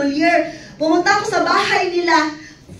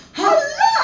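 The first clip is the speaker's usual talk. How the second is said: high startled hala; shocked it's about her; surprised gasp; 10 semitones higher than usual. Real, not acted. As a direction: jolt of realization. Sudden realization.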